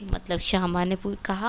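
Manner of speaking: ordinary delivery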